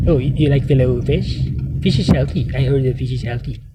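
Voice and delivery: put-on mock president voice; McDonald Trump. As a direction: play the president as a burger pusher. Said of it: "that's the way he speaks".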